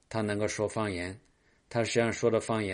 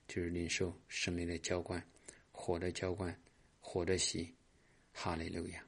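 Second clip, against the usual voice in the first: soft, murmured charismatic prayer; a pastor praying softly over the congregation; hushed prayer; 7 dB quieter than usual. Acted. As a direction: pray quietly, welcoming the Spirit. Leads a soft Spirit prayer.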